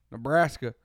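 loud, quick Texan "Nebraska"; mock Texan "Nebraska", hard on BRAS; Texan pronunciation. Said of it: "a Texan comes in and pronounces it".

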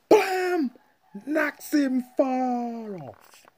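very high, loud drawn-out yell; hammed-up impact shout, words stretched out; explosive retelling. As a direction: shout the blow like a cartoon knockout. Acting out the knockout blow.

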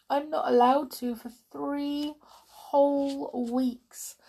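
put-on slow, dismal little girl; a small girl's slow dismal complaint; dismally. A narrator's reading. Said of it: "said Merry dismally".

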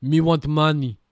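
loud, dumb caveman-style demand; hammed-up money-hungry voice; greedy company. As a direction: bellow it as a cartoon greedy brute. Mocking greedy game companies.

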